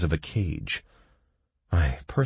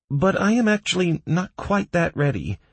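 high-pitched nervous admission; worried doctor, pitch way up; anxious; 14 semitones higher, 4 dB louder than usual. A narrator's reading. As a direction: admit your nerves in a much higher voice. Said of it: "anxiously glancing down".

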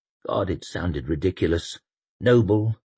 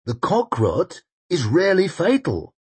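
grand general voice; 9 semitones higher, 3 dB louder than usual. Acted. Koska voiced.

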